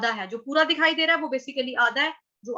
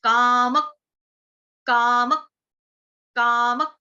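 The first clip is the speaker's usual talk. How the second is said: कामक with a loud hit on the half क; stress on half k; 5 dB louder, slower than usual. Shown as a demonstration. Teacher showing the stress before क्र in कामक. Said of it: "आघात किसका लगेगा? आधे क का लगेगा".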